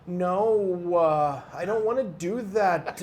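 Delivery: a lightly sung-out mock Paisa lilt, drawn slowly; mocking Paisa accent. Mocking the Paisa accent to a friend, lightly sing-song. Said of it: "that dumb accent".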